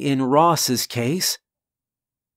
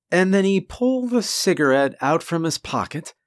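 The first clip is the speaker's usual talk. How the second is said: usual speaking voice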